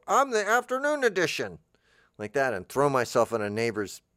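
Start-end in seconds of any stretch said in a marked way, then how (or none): 0.1-1.6 high put-on voice of a rolled-up newspaper. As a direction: pitch it way up like a talking newspaper.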